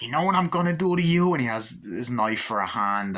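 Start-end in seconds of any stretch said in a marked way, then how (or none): 0.0-1.3 high, loud, mocking killer doll; taunting Chucky. Chucky taunts Andy with his knife hand, mocking voice. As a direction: tease the victim with menace. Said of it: "He's like".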